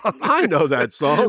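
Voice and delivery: slow, drawn-out jingle-style title read; segment title announcement. Acted. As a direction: stretch the title out like a show jingle. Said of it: "a little segment of".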